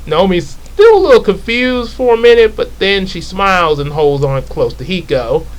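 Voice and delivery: ordinary talk, nothing marked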